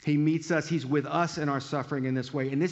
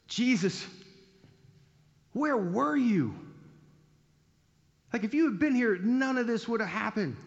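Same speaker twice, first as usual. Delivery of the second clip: raised, pleading voice of a grieving sister; distraught plea; 9 semitones higher than usual. Retold or imitated. Grieving Mary confronts late Jesus, pleading higher.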